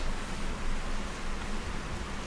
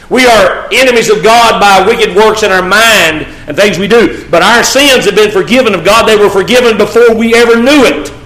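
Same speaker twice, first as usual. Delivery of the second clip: loud, high impassioned preaching; preaching at full force; 8 semitones higher, 6 dB louder than usual. Acted. Preacher rousing the brethren, loud impassioned preaching. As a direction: lift the congregation, preaching hard.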